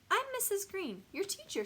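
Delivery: high, sweet teacher voice; gentle high-pitched friendly greeting; smiling. A narrator's reading. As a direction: introduce yourself through a smile. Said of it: "she smiles".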